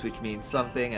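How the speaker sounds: ordinary talk, nothing marked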